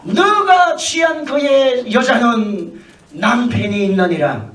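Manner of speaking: slow, booming solemn decree; drawn-out divine warning; voice of God. Acted. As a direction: proclaim it slowly like God from heaven. God speaks to Abimelech in dream.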